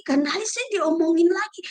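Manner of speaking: ordinary delivery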